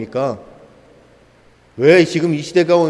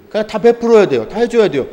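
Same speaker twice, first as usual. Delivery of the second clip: mocking entitlement; 7 semitones higher, 5 dB louder than usual. Mocking entitled people's demands.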